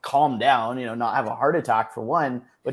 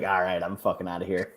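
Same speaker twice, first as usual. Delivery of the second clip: put-on lower, quieter Long Island accent; 8 semitones lower, 8 dB quieter than usual. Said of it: "I gave him my Long Island accent".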